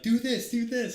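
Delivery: loud, high imitation of frantic wife; urgent shouted orders. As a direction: imitate a wife yelling instructions. Wife giving urgent orders in canoe.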